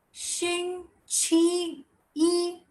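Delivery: 星期一 very slow, each syllable high and held; careful repetition. Shown as a demonstration. Teacher repeats Monday for class, slow careful repetition. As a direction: draw 星期一 out in three high level syllables.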